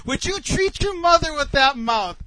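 loud, high reproach; acting out an outraged wife; indignant scolding. Wife's angry challenge retold.

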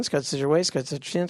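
ordinary talk

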